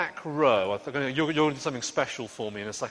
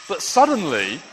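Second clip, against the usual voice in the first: dramatic storm build; 10 dB louder than usual. A narrator's reading.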